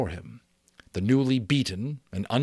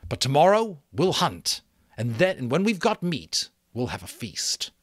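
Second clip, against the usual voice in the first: higher, louder rousing call; rallying chief; 8 semitones higher, 5 dB louder than usual. Acted. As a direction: rouse the tribe with promise of meat. Chief rallies the tribe.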